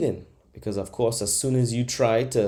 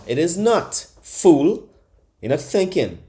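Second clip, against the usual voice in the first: scolding; 6 semitones higher, 5 dB louder than usual. Acted. Comic scolds imagined doubter.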